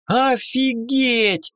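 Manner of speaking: high, slow delighted fairy shout; a fairy shrieking with joy; excited yell. Acted. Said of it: "заорала Тринь-Брень". (восторженный крик феи)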